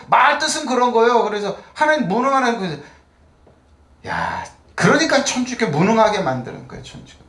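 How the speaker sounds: high, insistent sermon voice; fervent preaching. Real, not acted. Pastor rebuking a fatalistic view before the congregation, fervent high preaching. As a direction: press the point with rising fervour.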